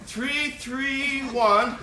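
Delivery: high loud yell, first words slow; boy in a tree shouting his number to the neighbours. Retold or imitated. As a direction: bellow the digits up to the window.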